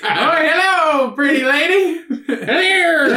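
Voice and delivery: high, slow, sing-song charmer; hammed-up high leprechaun come-on; smarmy leprechaun greeting. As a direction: croon it like a sleazy flirt. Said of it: "he goes".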